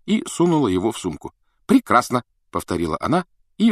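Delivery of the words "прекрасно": raised, offended exclamation; indignant retort. A narrator's reading. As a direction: snap it out, packing up to go. Повторяет, собираясь уйти. (обиженно, резко)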